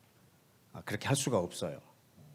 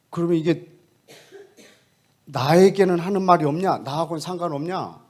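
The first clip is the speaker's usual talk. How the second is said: loud, raised, testy challenge; irritated questioning; 5 semitones higher, 9 dB louder than usual. Retold or imitated. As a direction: fire back the question with a snap of annoyance. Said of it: "질문이 얼마나 막 신경질적인지 몰라요". (신경질 내며 따지듯이)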